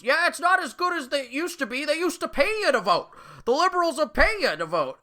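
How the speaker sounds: put-on high, quavering old lady. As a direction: play a cheerful old woman. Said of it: "And she goes".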